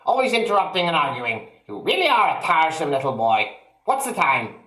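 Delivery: put-on high nagging old woman voice; cranky grandma. A narrator's reading. Grandma nagging her grandson, high and fast. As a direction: scold like a sour old grandmother.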